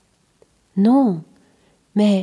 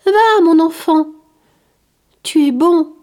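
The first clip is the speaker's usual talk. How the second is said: put-on higher, louder voice of a sick old man; a kind outcast reassuring a curious child; gentle leper; 7 semitones higher, 5 dB louder than usual. The leper tells the boy Curius why people flee, gently insisting.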